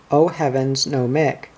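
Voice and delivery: plain reading voice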